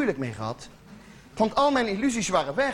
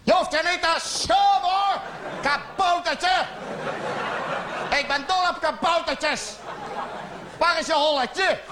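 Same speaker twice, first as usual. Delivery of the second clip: condescending coo; 5 semitones higher than usual. Acted. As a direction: talk down to him like a small child. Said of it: "Dus ik zei".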